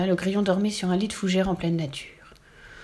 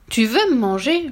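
louder, higher indignant question; reproachful; 5 semitones higher, 5 dB louder than usual. A narrator's reading. Princess learning he would eat her, reproachful. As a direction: reproach him in mild outrage. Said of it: "lui reprocha Mirabelle".

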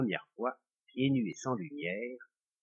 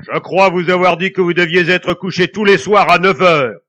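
loud, higher scolding ghost; ghostly scolding; 6 semitones higher, 17 dB louder than usual. A narrator's reading. Ghost ordering the couple to bed, loud angry scolding. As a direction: scold them like a furious master.

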